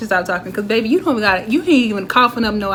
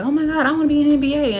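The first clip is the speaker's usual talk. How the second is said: higher, quick burst of mock excitement; excited kid; 6 semitones higher, faster than usual. Kids at the basketball court dreaming big, acted excitement. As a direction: imitate a starstruck kid, fast and high.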